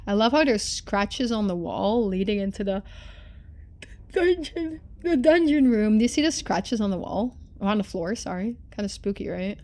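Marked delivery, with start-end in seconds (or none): none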